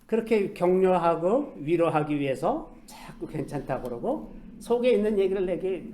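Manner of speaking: ordinary delivery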